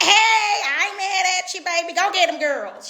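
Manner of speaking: high, bright mock pep talk; high cheery encouragement. Cheering on followers who hustle, high and playful. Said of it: "and I'm like".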